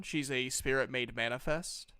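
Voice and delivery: ordinary talk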